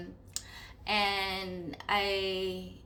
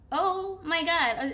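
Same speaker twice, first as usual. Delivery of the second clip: high, loud, slow dismay; panicked; 8 semitones higher, 5 dB louder, slower than usual. Retold or imitated. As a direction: let the fear spill out. Panic at leaving body.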